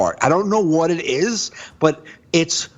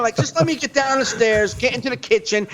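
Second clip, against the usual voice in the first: exasperated; 10 semitones higher than usual. Retold or imitated. Exasperated retelling of morning routine.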